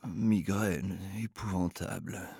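soft, slow mumble; a pale sick actor mumbling to Filia; mumbled. Acted. Pained mumble of migraine. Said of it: "en bredouillant".

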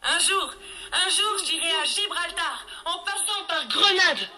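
put-on high, distant child's call; high-pitched, far-off girlish cry; little girl crying to the sky. A narrator's reading. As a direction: call out a dream to the sky like an eight-year-old. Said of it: "elle crie au ciel".

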